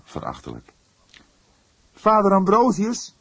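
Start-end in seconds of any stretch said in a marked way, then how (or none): 1.9-3.1 put-on higher, louder indignant young voice; indignant outcry. Young detective outraged at the old priest, calling out indignantly. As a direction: burst out in outrage. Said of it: "riep hij verontwaardigd".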